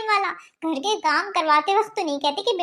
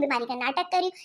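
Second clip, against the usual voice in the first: mocking refrain; 7 semitones lower, 6 dB quieter than usual. [name] mocks her husband's suspicion, low sarcastic drop. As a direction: drop much lower and softer, sarcastic.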